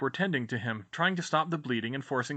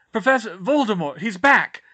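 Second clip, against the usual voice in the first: loud, high alarmed warning; urgent shout; 8 semitones higher, 10 dB louder than usual. Acted. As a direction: warn him at once.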